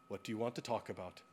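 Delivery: quick, soft, offhand question; brisk therapist question. Retold or imitated. Therapist opening the session, quick and soft. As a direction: toss it off like a routine opener. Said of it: "he said".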